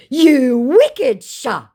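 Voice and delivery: very slow, high, loud impression; mock indignant scammer. Scammer reproaching the baiter, mock indignant. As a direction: drag out the reproach in mock outrage.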